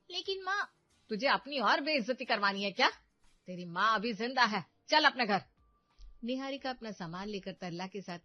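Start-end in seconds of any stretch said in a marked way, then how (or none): 0.1-0.7 hesitant daughter. Acted. 1.1-5.4 loud, fierce order to leave; indignant mother. Acted. Mother orders daughter to leave husband's house, loud.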